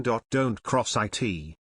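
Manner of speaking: each word drawn out slowly and heavily; a mock-stern dad dragging out his warning. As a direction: spell out the rule very slowly, like a hard father. Father laying down the rule.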